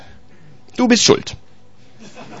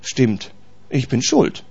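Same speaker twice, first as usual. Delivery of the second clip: quiet humble admission; 7 semitones lower, 10 dB quieter, slower than usual. Retold or imitated. Humble confession voiced.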